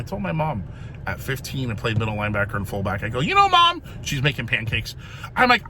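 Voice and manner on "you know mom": high, bright-eyed opener to mom; eager call to mom. Retold or imitated. Teen son eagerly calling to mom in the kitchen, high and louder. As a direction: pitch it up like a boy bursting to tell. Telling mom while she cooks.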